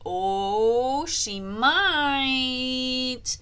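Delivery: ordinary talk, nothing marked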